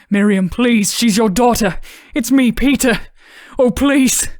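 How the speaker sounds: loud, rising, begging voice; desperate pleading. Acted. Father pleading with his raging wife.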